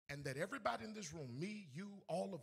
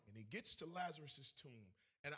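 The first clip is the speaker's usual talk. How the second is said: ordinary delivery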